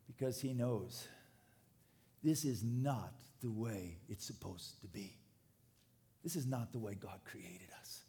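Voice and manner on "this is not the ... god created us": low, subdued grief, growing softer; hushed lament. Acted. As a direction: lament it quietly, as a mourning prophet would. Voices the prophet's grief, then builds.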